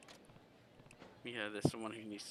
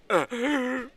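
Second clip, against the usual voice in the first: high mock exhausted grunt; high strained grunt; 16 dB louder than usual. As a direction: squeeze out a high, strained groan.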